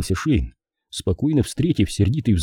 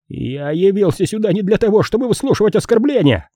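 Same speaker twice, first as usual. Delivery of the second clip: outraged, very high-pitched protest; indignant squeal; 11 semitones higher than usual. A narrator's reading. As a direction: squeal it like an insulted official. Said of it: "взвизгнул Питер Пейнтер". (возмущённый визгливый протест)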